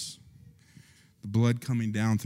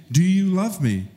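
pleading question; 8 semitones higher, 4 dB louder than usual. Acted. Jesus asking Peter again.

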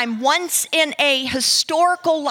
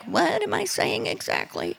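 bewildered aside; 3 semitones higher, 6 dB quieter than usual. Retold or imitated. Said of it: "you're like".

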